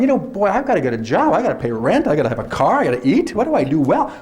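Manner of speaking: a teacher daydreaming that screaming could pay the rent; imitating Gloria musing. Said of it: "Was Gloria going at one point going".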